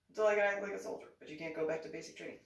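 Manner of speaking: fast loud repeat of the phrase. Shown as a demonstration. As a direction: fire it off quickly and loudly, first words loudest. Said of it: "I just said that".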